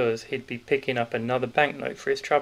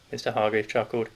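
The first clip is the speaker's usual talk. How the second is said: usual speaking voice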